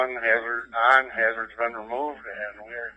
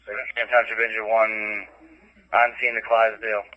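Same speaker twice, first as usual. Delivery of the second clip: terse radio update; 4 semitones higher, 9 dB louder than usual. Real, not acted. Engine reporting on scene.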